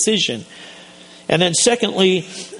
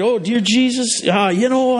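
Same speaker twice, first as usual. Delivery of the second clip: eager prayer; 6 semitones higher than usual. Retold or imitated. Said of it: "He just started".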